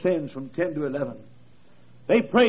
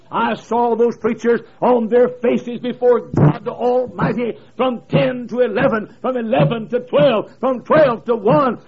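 slow, louder emphatic preaching; building preaching; 5 semitones higher, 7 dB louder than usual. Acted. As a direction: pound out the hours. Rising preaching close.

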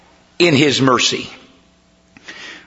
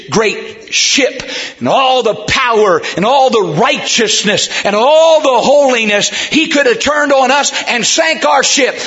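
building preacher cadence, rising high; fervent preaching; 7 semitones higher than usual. Acted. Preacher picturing God's great ship, fervent and climbing. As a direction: pile up each phrase with rising fervour.

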